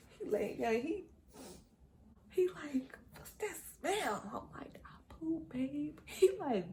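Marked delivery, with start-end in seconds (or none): 3.2-4.2 high, slow whispered question; imitated sleepy man. Man waking, noticing smell. 4.8-6.0 high, soft whispered admission; sheepish confession. Retold or imitated. Embarrassed confession to partner.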